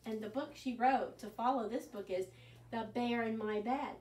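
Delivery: ordinary delivery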